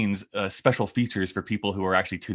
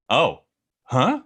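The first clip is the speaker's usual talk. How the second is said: mock surprise; 4 semitones higher, 3 dB louder, slower than usual. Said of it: "this is me re-recording my lines".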